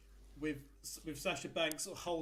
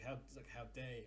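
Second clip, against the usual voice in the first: usual speaking voice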